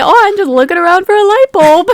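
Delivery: fast, high, sing-song mock of the player's line; mocking paraphrase. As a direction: make it sound silly on purpose. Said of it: "when you say it like that".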